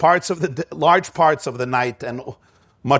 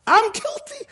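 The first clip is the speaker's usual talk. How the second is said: much higher, rising plea; Moshe repeating guilty. Retold or imitated. Moshe repeats his guilt to the sheep, rising higher. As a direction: let the pitch climb up into a wail on guilty. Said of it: "Moshe says this".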